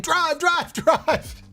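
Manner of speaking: high, frantic mock cry of the cousin fleeing; panicked getaway order. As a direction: squeal it like a panicked man diving into the back seat, laughing.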